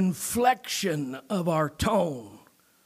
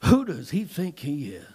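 disdain; 6 semitones lower than usual. Acted. Pharisees in the crowd asking about Jesus, disdainful. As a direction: look down on him with a lowered, scornful voice. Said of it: "from a point or an air of disdain".

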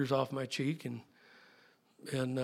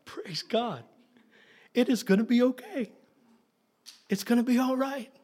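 relieved praise; 8 semitones higher than usual. Retold or imitated. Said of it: "And I'm like".

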